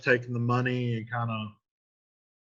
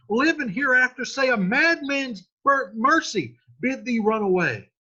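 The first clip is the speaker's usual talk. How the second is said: much higher and louder, pleading; desperate Romeo; 12 semitones higher, 6 dB louder than usual. Acted. Romeo warning Paris off, desperate loud plea. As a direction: beg him to get away. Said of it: "And Romeo says".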